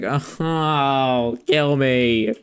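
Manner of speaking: long, drawn-out groaning plea; hammed-up weary dismay; mock despair. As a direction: act crushed at still being alive. Retold hero dismayed to be alive.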